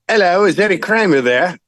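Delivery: put-on English accent on the phone. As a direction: imitate an English caller asking politely. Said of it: "there's an English, you know, person".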